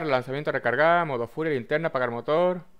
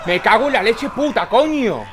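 frustrated cursing; 7 semitones higher, 5 dB louder than usual. Real, not acted. Frustrated swearing, laugh at end.

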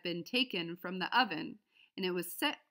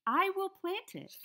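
much higher, slow, chirpy hen voice; a small hen making up her mind; determined little hen; 11 semitones higher, 4 dB louder than usual. A narrator's reading. The little red hen, deciding on her own, in a high hen voice.

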